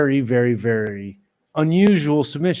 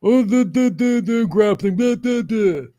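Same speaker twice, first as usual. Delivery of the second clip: much higher, louder stammering idiot voice; dopey mock voice; 8 semitones higher, 4 dB louder than usual. Taunting the dummies with a high stammering mock voice. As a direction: stammer like a clueless fan. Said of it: "you stupid dummies".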